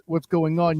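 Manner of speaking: ordinary delivery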